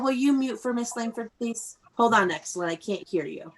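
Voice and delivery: normal talking voice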